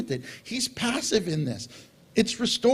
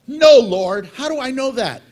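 loud refusal; 3 semitones higher, 10 dB louder than usual. Retold or imitated. Man voiced refusing Jesus.

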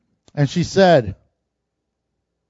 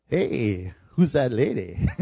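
much lower and softer, a lazy leering drawl; crude pickup line; 8 semitones lower, 6 dB quieter than usual. Retold or imitated. What Boaz did not say, low and leering. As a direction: toss it off like a guy eyeing a girl. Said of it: "He doesn't say that".

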